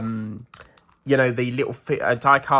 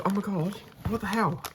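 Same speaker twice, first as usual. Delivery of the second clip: ordinary talk, nothing marked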